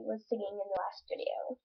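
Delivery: soft whispered aside; whispering. Real, not acted. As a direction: drop to a whisper.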